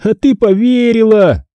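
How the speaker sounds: high, drawn-out taunt; gloating singsong jeer; mocking drawl. A narrator's reading. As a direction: tease mockingly, stretching the words. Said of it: "издевательски протянул Дэниел".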